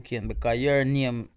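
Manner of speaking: ordinary talk, nothing marked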